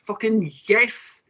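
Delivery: drawn-out, high delighted yes; gleeful slow exclamation; slow gleeful cheer. Real, not acted. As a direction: savour the word slowly. Delighted at escape artist reveal.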